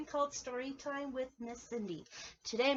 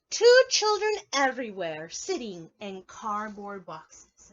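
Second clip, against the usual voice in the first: read-aloud voice; 10 dB louder, slower than usual. Acted. Reading the story text.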